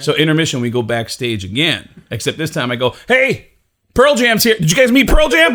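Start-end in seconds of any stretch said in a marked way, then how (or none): 3.1-5.5 loud, high, excited shout; boastful announcing. Retold or imitated. Introducing a famous friend backstage at intermission, boastful shouting. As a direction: show off the famous friend to all.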